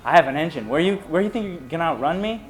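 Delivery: mild mock taunt, rising at the end; lightly acted taunt; retold taunting. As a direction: re-enact jeering at someone you are chasing. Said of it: "just taunting".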